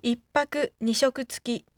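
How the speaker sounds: normal talking voice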